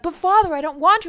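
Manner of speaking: high pleading daughter voice; whiny daughter. Retold or imitated. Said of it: "she would be like".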